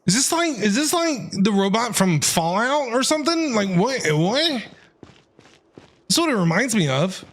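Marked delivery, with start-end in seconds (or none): none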